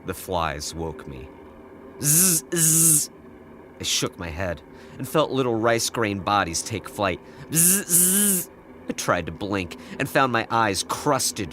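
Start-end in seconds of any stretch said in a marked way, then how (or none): 2.0-3.1 high thin buzzing; buzzing fly imitation. 7.5-8.5 high thin buzzing; buzzing fly imitation.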